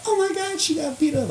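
high, shocked gasp of a crowd. Retold or imitated. Imitating fans stunned by the loss, high and shocked. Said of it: "everyone's like".

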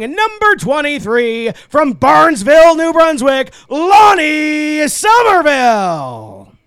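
booming announcer, names stretched and shouted; driver introductions. Acted. Track announcer introduces the starting field.

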